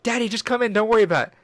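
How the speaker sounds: imitating a wheedling kid; mock coaxing. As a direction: coax daddy in a squeaky voice. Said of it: "We're like".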